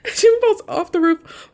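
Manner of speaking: high, loud, just after laughing; amused. Real, not acted. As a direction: say it on the tail of a real laugh. Retelling fall through own laughter.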